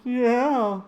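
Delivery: loud, high, strained yeah; Neil Hamburger voice. Retold or imitated. Fan showing how Neil Hamburger says yeah. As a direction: bark out one sour comic yeah. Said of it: "I just love the way he says".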